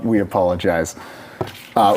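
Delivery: ordinary delivery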